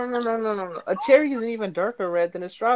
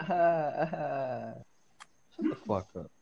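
unenthusiastic; 6 semitones lower, 9 dB quieter, much slower than usual. Real, not acted. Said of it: "That was so unenthusiastic".

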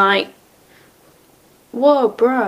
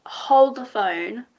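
slow; much slower than usual. Real, not acted. Stopping to realize something.

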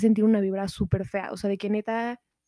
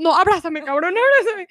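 higher, louder mock begging; imitating a terrified friend begging for a hug; pleading scared friend; 9 semitones higher, 5 dB louder than usual. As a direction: lightly play a frightened friend clinging. Scared friend begs to be hugged.

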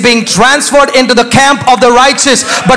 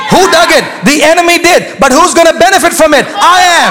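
preaching at full force; 9 semitones higher than usual. Acted. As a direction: preach it out to the back row. Preacher building to full shout.

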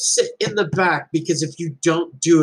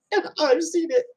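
choked up; 11 semitones higher than usual. Real, not acted. Proud parent tearing up.

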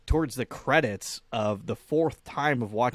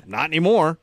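louder, higher retold retort; emphatic punchline; 5 semitones higher, 8 dB louder than usual. Friend asked about seeing her again, loud emphatic retort. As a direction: blurt it out as the punchline.

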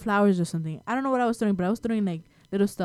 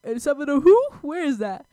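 put-on confused child voice; 8 semitones higher, 5 dB louder than usual.

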